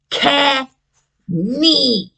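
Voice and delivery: slow demo. Shown as a demonstration. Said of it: "some sounds in his name".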